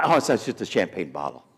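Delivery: normal talking voice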